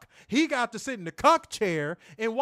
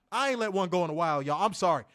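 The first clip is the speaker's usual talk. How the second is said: usual speaking voice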